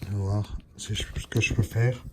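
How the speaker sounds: whispered to himself; whispering. Real, not acted. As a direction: breathe the words, no voice. Muttering while struggling with phone.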